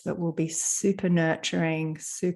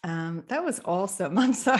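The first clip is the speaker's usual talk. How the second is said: ordinary delivery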